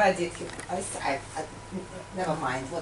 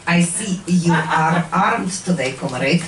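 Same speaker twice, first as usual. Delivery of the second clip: put-on Russian accent; 2 semitones lower than usual. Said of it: "KGB comedy act".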